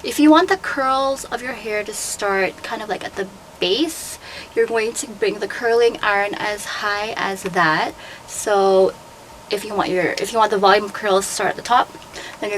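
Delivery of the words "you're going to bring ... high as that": usual speaking voice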